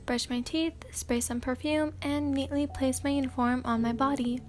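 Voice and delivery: ordinary delivery, nothing marked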